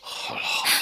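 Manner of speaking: breathy, near-whispered comfort; calm her down gently; hushing the crying lady; soothing hush. Acted. Minister soothes weeping wife.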